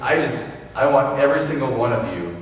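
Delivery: usual speaking voice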